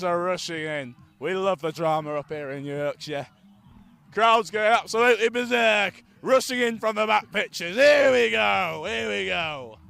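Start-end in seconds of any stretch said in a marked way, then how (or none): 4.1-9.8 loud, high hype call, building; excited commentary. Real, not acted. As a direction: whip the crowd up as bodies pile in. Hyped commentary as benches rush.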